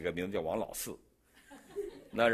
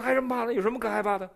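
carefree disbelief; 11 semitones higher, 5 dB louder, faster than usual. Retold or imitated. Farmer shrugs off the danger.

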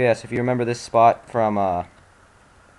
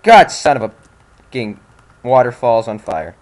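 loud angry rant; 10 dB louder than usual. Real, not acted. Gamer raging at game.

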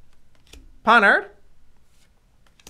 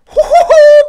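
high, loud burst of giddy laughter; delighted laugh; 13 semitones higher, 13 dB louder, slower than usual. Real, not acted. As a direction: laugh out in pure delight at the pull. Pulled a gold rare card.

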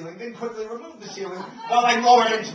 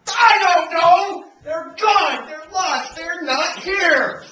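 loud, high raging yells; furious shouting; 7 semitones higher, 8 dB louder, slower than usual. Acted. Husband alone in suite, yelling over lost tickets, furious shout.

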